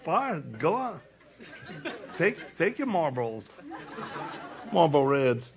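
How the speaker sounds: laughing mock scolding of his wife; teasing wife, laughing. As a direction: scold her jokingly through laughter. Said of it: "I was like".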